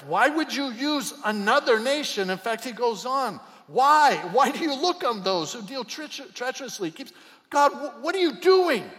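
high, loud pleading why; preacher voicing prophet's cry; anguished outcry. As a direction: shout the protest up to heaven. Prophet questioning God, preached.

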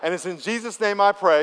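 rising, forceful prayer call; loud preacher's command. Shown as a demonstration. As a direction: call it out for the room to echo. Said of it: "say this out loud".

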